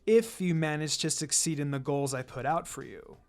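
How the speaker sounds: a calm assassin laying down the law to his students; stern sensei Kakashi. Acted. Kakashi addresses his team.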